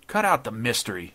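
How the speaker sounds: loud, impatient snap. A narrator's reading. Intruder to the evasive judge, snapping impatiently. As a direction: cut him off impatiently. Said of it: "snapped Dodd impatiently".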